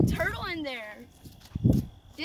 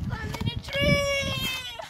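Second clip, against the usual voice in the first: slow drawn-out yell; shouting; 12 semitones higher, 6 dB louder, slower than usual. Acted. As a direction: call it out loud and slow. Kid shouting while playing Link.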